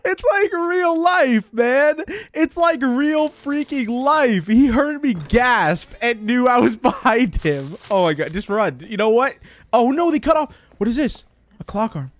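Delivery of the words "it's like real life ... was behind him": high, slow, drawn-out lament; exasperated wail. Real, not acted. Player caught by his own gasp, wailing. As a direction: wail it, stretching the words. Lost because he gasped.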